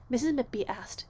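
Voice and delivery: ordinary delivery, nothing marked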